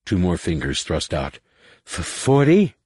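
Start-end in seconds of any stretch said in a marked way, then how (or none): 1.9-2.8 higher, stumbling over forty; a drunk fumbling the number; drunken stammer. A narrator's reading. Drunk Findian loses count.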